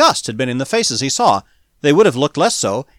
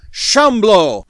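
loud bellowed cry, pitched up and falling; deep-throated bellow; 7 semitones higher, 8 dB louder than usual. A narrator's reading. Mob leader sighting his quarry, deep-throated bellow. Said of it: "let loose a deep-throated bellow".

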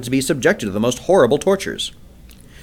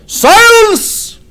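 a huge, very high roar; 10 dB louder than usual. A narrator's reading. The jedak roars at the council for silence. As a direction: bellow it to silence the hall. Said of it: "roared Tal Hages".

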